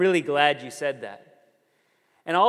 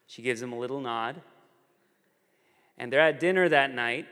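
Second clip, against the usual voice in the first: usual speaking voice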